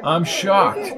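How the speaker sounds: mock shock, pitched a little up; understated sarcasm; sarcastic. A narrator's reading. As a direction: feign surprise drily. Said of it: "said Jones sarcastically".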